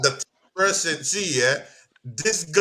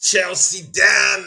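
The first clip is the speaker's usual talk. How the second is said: drawn-out announcer shout; 5 semitones higher, 6 dB louder, much slower than usual. Acted. Hypes up the next guest.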